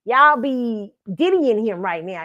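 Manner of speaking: ordinary delivery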